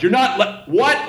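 high, incredulous cry of what; stunned disbelief. Retold or imitated. Drunk customer stunned at being cut off, incredulous. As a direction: pitch jumps way up in disbelief. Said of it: "he couldn't believe he'd been cut off".

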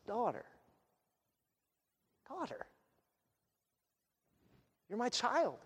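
soft, gentle address to a daughter; tender. Acted. Jesus reassuring the healed woman, tender and hushed. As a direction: say it very softly, with space between phrases.

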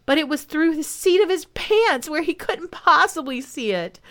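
plain reading voice

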